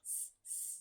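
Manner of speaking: hissed S-, s-, unvoiced; isolated S sound. Shown as a demonstration. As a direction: hiss the letter S twice. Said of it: "One of my favorite S words".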